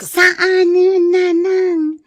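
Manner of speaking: high, loud crying protest to her mother; tearful plea. Acted. Daughter pleads with mother over pregnancy.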